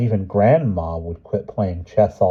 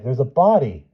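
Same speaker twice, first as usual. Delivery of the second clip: cried; 6 semitones higher, 3 dB louder than usual. A narrator's reading. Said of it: "he cried".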